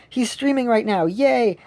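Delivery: high-pitched little cheer retold; excited realization. Acting out his excited realization.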